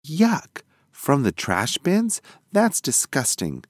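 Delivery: put-on a young mouse grossed out; disgusted. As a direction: turn up your nose at the trash. Georgie disgusted at trash bins.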